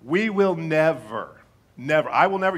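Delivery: insistent warning from the pulpit; emphatic never. Real, not acted. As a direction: lean hard on each never. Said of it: "I want to address this from the pulpit".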